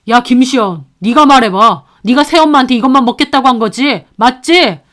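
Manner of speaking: father bullying his daughter; pressuring demand. Acted. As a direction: push the child to agree, fast.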